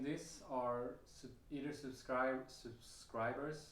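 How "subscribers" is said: ordinary delivery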